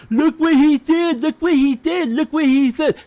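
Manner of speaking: high, whining mock of a complainer; mocking whiny critic. Streamer mocking critics in a high whiny voice. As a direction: sneer it like a tattling child. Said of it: "sitting there going".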